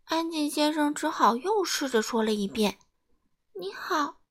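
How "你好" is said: soft, high, tiny voice; timid small voice. A narrator's reading. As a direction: speak up very quietly and meekly. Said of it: "他小声地说". (又轻又细地小声说)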